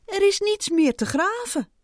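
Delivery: high-pitched calling voice. A narrator's reading. Ant calling from under the wall, shouting. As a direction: shout it back from far down. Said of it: "hoorde de eekhoorn hem roepen".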